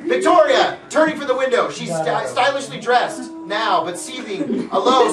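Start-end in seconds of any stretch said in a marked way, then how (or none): none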